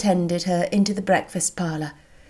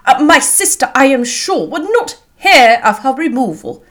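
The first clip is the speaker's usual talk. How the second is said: loud, raised-pitch protest; earnest outcry; 5 semitones higher, 6 dB louder than usual. A narrator's reading. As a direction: insist emphatically as an indignant host. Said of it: "cried Bingley".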